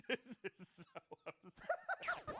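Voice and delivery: slow, laughing despair. Real, not acted. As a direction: laugh through the complaint. Laughing at terrible food.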